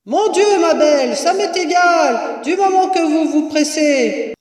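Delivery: high, projected exasperation; impatient shout. A narrator's reading. Mistress hurries woman in cellar, impatient shout. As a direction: call down to hurry her along.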